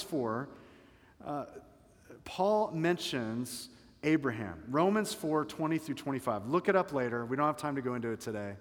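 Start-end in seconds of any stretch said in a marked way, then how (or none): none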